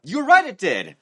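worked-up acted reply; irritated retort. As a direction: snap back as if provoked, lightly acted.

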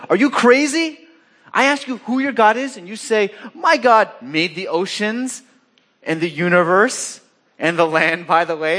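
high-pitched, acted-out disbelief; incredulous. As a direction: throw his claim back at him in disbelief. Acting out sailors' disbelief at Jonah.

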